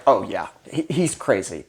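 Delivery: low, soft audience aside; deadpan agreement. Retold or imitated. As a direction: imitate onlookers quietly concurring. Audience's muttered verdict, acted.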